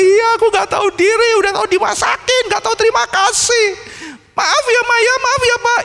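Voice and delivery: put-on a child saying sorry to get his meals back; a small child humbly begging forgiveness; pleading child. Child apologizing to parents.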